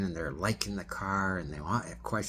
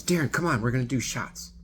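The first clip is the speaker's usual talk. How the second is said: much higher, louder party push; animated invitation; 7 semitones higher, 7 dB louder, faster than usual. Retold or imitated. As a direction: egg him on to do shots. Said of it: "very animated".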